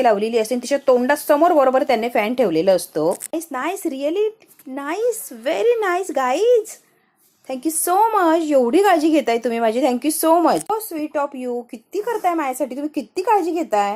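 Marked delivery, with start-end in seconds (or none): 3.3-13.9 high, drawn-out, effusive praise; gushing thanks. Acted. Daughter-in-law thanks the boys for their care, gushing. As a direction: gush slowly in a raised voice, stretching the words.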